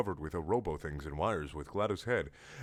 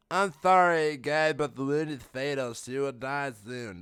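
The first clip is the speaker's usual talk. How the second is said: put-on medic voice; 5 semitones higher than usual. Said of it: "That's medic".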